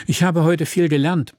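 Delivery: earnestly announcing; as earnest as possible. A narrator's reading. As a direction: say it with all seriousness, voice lifted. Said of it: "sagte Thomas so ernst wie möglich".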